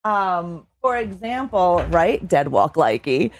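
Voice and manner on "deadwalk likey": usual speaking voice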